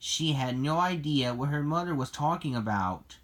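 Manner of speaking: ordinary delivery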